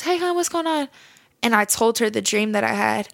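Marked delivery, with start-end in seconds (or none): 0.0-0.9 lightly voiced godmom, higher pitch. Godmom greeting her goddaughter on a call, lightly imitated in a higher voice. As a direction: lift the voice a little for a caring older relative. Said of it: "my godmom calls me, and she says".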